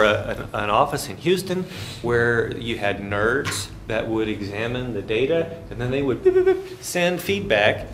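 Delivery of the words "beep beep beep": high imitated electronic beeps; satellite beeps. Lecturer mimicking a wellhead signal, high beeps. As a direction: beep like a data transmitter.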